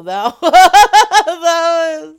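loud burst of laughter through the words; cracking up mid-sentence; laughing. Real, not acted. Host cracking up at the prank call, laughing loudly.

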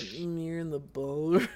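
imitating the receptionist. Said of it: "She said".

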